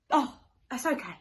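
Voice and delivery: ordinary delivery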